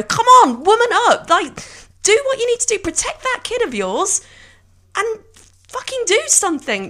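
loud, high scolding nanny; bossy nanny shaking some sense into her; British nanny. Pretend. Podcaster scolding a film character like a nanny, loud and high. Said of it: "shake her like a British nanny and go".

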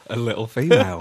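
laughing as he repeats it. Real, not acted. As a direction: echo it through a laugh.

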